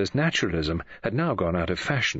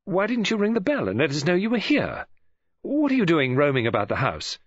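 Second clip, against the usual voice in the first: higher-pitched indignant questioning; angry; 7 semitones higher than usual. A narrator's reading. Mary scolds David for roaming about the house, angrily. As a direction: scold the uninvited visitor angrily. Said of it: "she added in an angry voice".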